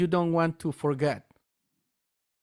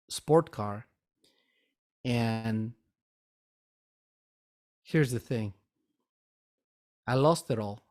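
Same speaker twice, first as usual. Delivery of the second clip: getting emotional; 4 semitones lower, 3 dB quieter than usual. Real, not acted. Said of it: "Sorry, I got a little emotional about this".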